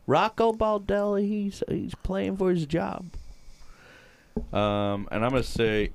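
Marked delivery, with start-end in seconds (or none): 0.1-3.1 high, slow mocking fan voice; mock a whiny fan, stretched out; mock Twins fan.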